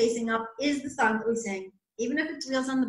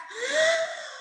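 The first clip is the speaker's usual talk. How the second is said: big breath in; 3 dB quieter than usual. Shown as a demonstration. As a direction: take a huge breath before singing. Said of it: "Big breath".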